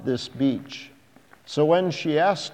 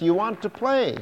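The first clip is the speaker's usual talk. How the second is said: put-on little girl voice; 8 semitones higher, faster than usual. A narrator's reading. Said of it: "she said".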